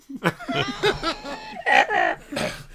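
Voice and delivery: very high, loud peal of laughter; the teller laughs at his own mange joke, high and loud; high laughing fit. Real, not acted. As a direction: burst out laughing at the punchline. Laughing at punchline.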